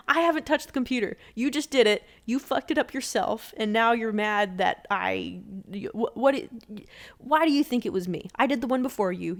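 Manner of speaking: loud, exasperated retelling of an argument; mad self-defense. Retold or imitated. Blamed worker arguing with the boss, mad. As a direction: argue back indignantly, stumbling over the words. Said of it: "I kinda got mad".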